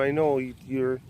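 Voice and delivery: ordinary delivery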